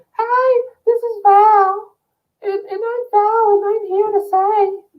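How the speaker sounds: high falsetto puppet voice; mannequin voice. Acted. Vlogger voicing his mannequin head, high falsetto. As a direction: squeak it out like a talking doll. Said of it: "I was just doing it for fake face".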